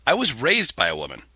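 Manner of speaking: ordinary talk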